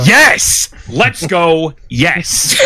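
sudden high, loud cheer of triumph. Real, not acted. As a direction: celebrate the win out loud. Cheering win of pineapple debate.

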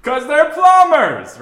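high, loud, drawn-out echo; mock shout. Host echoing the cohost's plumber joke, mock shout.

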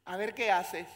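ringing out the challenge to God; loud challenge to God; loud repeat. Acted. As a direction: call it out loud.

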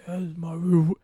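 low, quiet deep-voice impression; deep real voice. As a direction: drop low and soft for a deep voice. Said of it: "you know how [name]'s voice is deep?".

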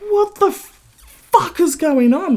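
high, slow, loud disbelief; incredulous outrage. Retold or imitated. Served after rude driver.

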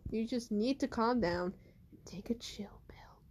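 hushed, whispered advice. Acted. Koiki whispers to Naomi to calm down. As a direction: whisper it like calming a friend.

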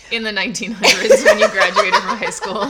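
loud, breaking into laughter; loud teasing giggle; laughing tease. Real, not acted. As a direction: tease through a big laugh. Teases her age, both laughing.

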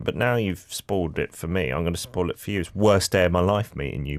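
ordinary delivery

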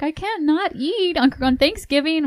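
high quoted voice. Retold or imitated. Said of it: "She was like".

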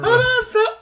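very high falsetto lady voice; female voice. Acted. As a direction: squeeze it up into a falsetto. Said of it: "I can do a female voice".

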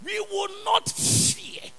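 preaching. Acted. Preacher declaims scripture.

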